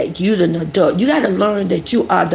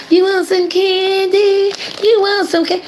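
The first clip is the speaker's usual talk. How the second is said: creepy candy-offering voice; 11 semitones higher than usual. Retold or imitated. Mocking a creator luring twins, high creepy coaxing voice. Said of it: "You sound like the boogeyman".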